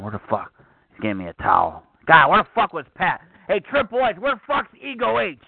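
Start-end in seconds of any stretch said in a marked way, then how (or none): 2.1-5.4 yelling around the office. Acted. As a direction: call out across the office. Yelling for absent staff.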